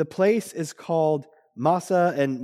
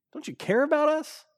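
high-pitched mock complaint; frustrated whine; 12 semitones higher than usual. Israelites challenge God over their thirst, whining in frustration. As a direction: plead indignantly, pitch pushed way up. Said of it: "a tinge of anger in their voice".

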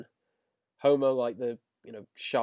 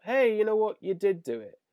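perky Homer; 7 semitones higher, 4 dB louder than usual. Retold or imitated. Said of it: "Homer perks up".

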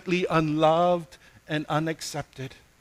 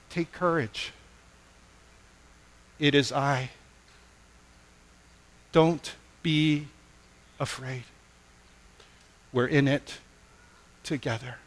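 gentle closing comfort; 3 semitones lower, 6 dB quieter than usual. Acted. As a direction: comfort the hurting listener.

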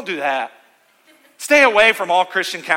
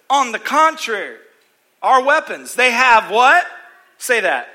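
preaching; 5 semitones higher, 6 dB louder than usual. Acted. Preaching call and response.

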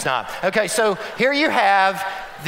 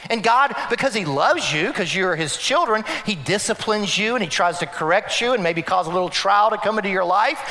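usual speaking voice

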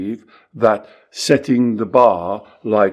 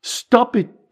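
firm command; 10 semitones higher than usual. Retold or imitated. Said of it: "that's when I say".

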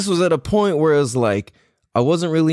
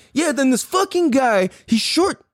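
high, heated complaint; climbing into outrage; indignant rant; 11 semitones higher than usual. Retold or imitated. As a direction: son venting to his mom about the cashier, high and indignant. Indignant retelling to family.

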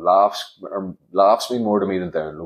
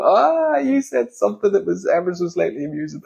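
high, gushing parody of a laughing sidekick; squeaky, over-the-top fake delight; mock fawning comedian; 9 semitones higher than usual. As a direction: send up a sycophant repeating the joke. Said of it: "laughing uncontrollably and then repeating".

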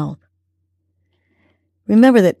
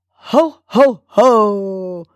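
mock ho ho ho; much slower than usual. Shown as a demonstration. Host showing the arms-up laugh exercise, mock ho ho ho. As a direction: belt a jolly ho ho ho with arms up. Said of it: "and say".

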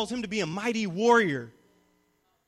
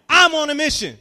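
full-energy shout; 8 semitones higher, 12 dB louder than usual. Shown as a demonstration. Pastor rallying the youth to chant it louder, a full shout. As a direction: belt it out, much higher and louder. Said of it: "say it like your life depends on it".

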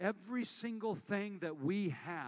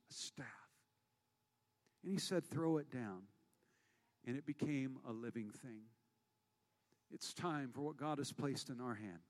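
hushed preaching; 5 semitones lower, 6 dB quieter than usual. Acted. Preacher quietly retelling Moses' staff to the congregation. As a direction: lower and softer, drawing the room in.

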